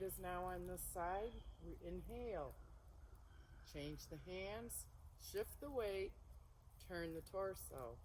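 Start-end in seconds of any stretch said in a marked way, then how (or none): 1.7-8.0 serene tai chi cues. Shown as a demonstration. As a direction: call each move gently, unhurried. Leads the flowing form.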